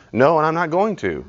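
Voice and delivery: a lightly played defiant child. Shown as a demonstration. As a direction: answer back like a child who won't budge. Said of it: "Parent, 'cause I told you to".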